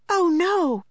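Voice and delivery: high alarmed cry. A narrator's reading. Lucy sees the water in the hall, a high alarmed cry. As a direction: gasp it out in sudden fear. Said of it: "cried Lucy".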